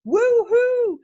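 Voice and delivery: very high, loud woohoo; excited whoop. Acted. Excited seller cheering her new washing products. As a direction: cheer like you just got the parcel. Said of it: "So excited".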